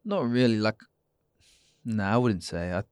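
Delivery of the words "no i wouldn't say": normal talking voice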